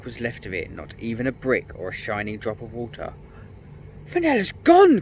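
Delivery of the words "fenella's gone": very high, loud frightened cry; scared cry. A narrator's reading. Peter crying out as Fenella vanishes, scared. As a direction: cry out in alarm. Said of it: "cried Peter, scared".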